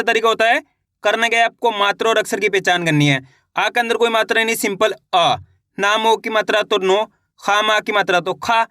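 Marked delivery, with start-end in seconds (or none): none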